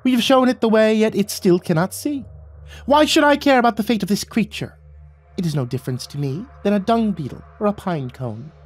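high, indignant little monarch; high-pitched royal disdain; frustrated sprite king. A narrator's reading. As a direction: complain imperiously in a sprite voice. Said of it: "threw his head up in frustration".

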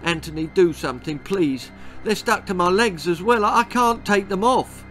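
high, fast pleading; panicked plea. A narrator's reading. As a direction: beg for help, panicking. The trapped boy pleads in panic.

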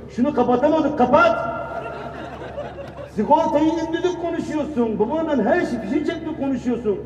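high-pitched exasperated nagging; mock scolding mother. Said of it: "bakın tepki şu". (cırlayan anne taklidi)

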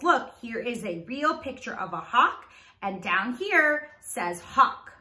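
normal talking voice

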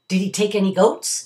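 a worried villager gasps it out; gasped. A narrator's reading. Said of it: "another gasped".

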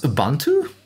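Ubuntu with an "oo" at the start, lifted as a question; questioned pronunciation. Retold or imitated. Viewer checking how the presenter pronounced Ubuntu, questioned pronunciation. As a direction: echo "Ubuntu" in surprise, opening on "oo". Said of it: "It's pronounced Ubuntu?".

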